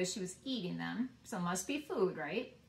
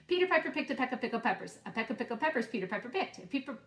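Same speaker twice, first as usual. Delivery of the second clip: fast tongue twister; 4 semitones higher, faster than usual. Shown as a demonstration. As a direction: rattle it off fast. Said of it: "Should I go faster?".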